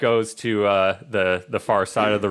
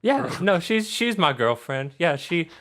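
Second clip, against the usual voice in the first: put-on higher-pitched, chatty little girl; sweetly sociable girl's voice; friendly Charlotte; 8 semitones higher than usual. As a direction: gush like a daughter about her best friend.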